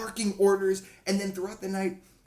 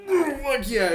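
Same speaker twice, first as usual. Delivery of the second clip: strained; 9 semitones higher, 6 dB louder, slower than usual. Retold or imitated. After swallowing foul liquor.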